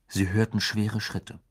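ordinary delivery, nothing marked

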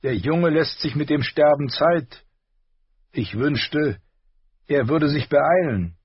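hint of a rasp, scornful; rasping Hound voice. A narrator's reading. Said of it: "schnarrende Stimme".

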